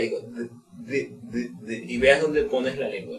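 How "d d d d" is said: soft, low "D" sounds, no E after them; quiet isolated "D" consonant, demonstrated; D without the vowel. Shown as a demonstration. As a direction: repeat "D" as a bare tongue tap. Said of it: "pero no le pongo la E".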